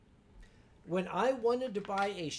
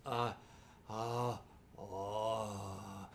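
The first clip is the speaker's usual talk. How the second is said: blissful groan; 12 semitones lower, 8 dB quieter, much slower than usual. Retold or imitated. Lying on the sheepskin, melting.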